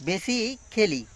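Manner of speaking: ordinary talk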